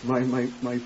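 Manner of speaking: low, soft, slow lament; grieving. Acted. Man grieving for his murdered sister, soft and slow. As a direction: mourn her quietly.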